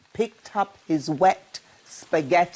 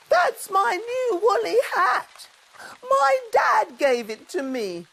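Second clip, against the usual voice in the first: high, upset cub voice; tearful. A narrator's reading. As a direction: plead with hurt. Douglas explains tearfully.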